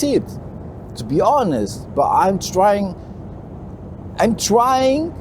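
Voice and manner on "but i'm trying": ordinary talk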